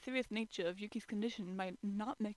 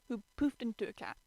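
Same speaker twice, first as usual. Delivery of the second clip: ordinary delivery, nothing marked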